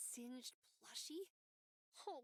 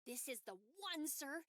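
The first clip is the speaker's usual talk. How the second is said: ordinary delivery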